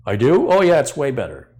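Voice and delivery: a hint of a naive shopper, instantly won over; gullible customer. Customer persuaded in store aisle.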